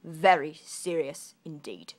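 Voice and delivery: loud, low and slow; grave soldier. Acted. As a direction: drag it out gravely.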